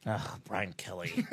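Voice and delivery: low, slow, fed-up groan of disgust. Real, not acted. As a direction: sound truly sick of him. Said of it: "Brian Kelly's annoying".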